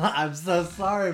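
giggling, drawn-out apology; laughing apology. Real, not acted. Co-host laughing apology to partner. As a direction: laugh through a sorry, slowly.

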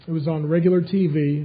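normal talking voice

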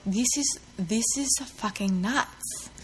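soft, slow attempt at posh; lightly put-on posh accent. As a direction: draw it out in an unsure posh voice. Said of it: "in his really posh accent".

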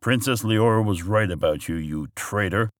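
ordinary delivery

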